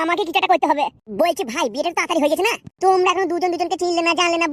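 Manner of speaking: ordinary talk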